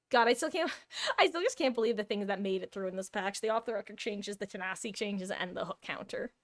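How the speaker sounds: ordinary talk, nothing marked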